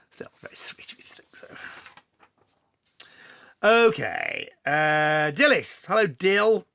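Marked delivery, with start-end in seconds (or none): 0.1-1.5 whisper. Real, not acted. Aside after laughing off compliment.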